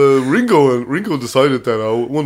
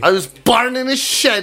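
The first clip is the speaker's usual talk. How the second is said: high, slow mock Kerry accent; 7 semitones higher, slower than usual. Repeating it to friends' praise, high slow Kerry accent. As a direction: drag it out in broad Kerry. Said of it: "Is that Kerry?".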